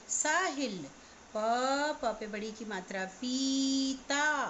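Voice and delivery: normal talking voice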